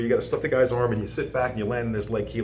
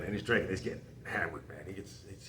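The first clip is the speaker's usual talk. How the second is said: hushed aside; 4 semitones lower, 14 dB quieter than usual. Real, not acted. Telling a secret mid-story.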